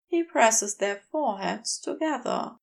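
usual speaking voice

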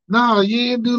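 ordinary delivery

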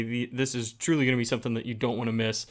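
normal talking voice

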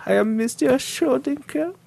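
high, sinister German introduction; creepy German voice. Retold or imitated. Creepy German villain bit.

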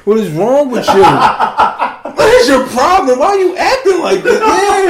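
high, loud, laughing through the scolding; laughing mock scold. Retelling the fight to friends, scolding through laughter. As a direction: crack up while yelling at her.

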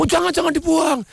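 high urgent plea; acted alarm. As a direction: plead in sudden panic. Victim begs to keep keepsake ring. (pura-pura panik memohon, nada tinggi)

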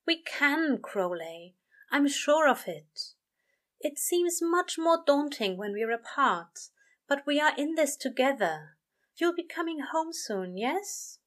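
lightly voiced angel reassuring his friend. A narrator's reading. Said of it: "aiming for confidence and reassurance".

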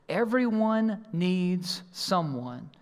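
slow emphasis. Acted. Sermon main point.

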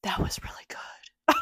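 slow breathy whisper; sexy whisper. Pretend. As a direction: whisper it slowly and seductively. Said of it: "You'd be like".

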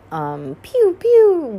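high, loud pew pew of a blaster shot; playful blaster noise; mock blaster pew. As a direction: fire off a toy-gun pew. Imitating blaster sounds.

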